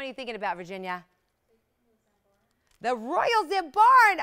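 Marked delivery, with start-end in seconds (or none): 2.8-4.2 loud, high, drawn-out exclamation; gushing excitement. Real, not acted. TV host showing off a prized gem, gushing. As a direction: exclaim it slowly and loudly, thrilled.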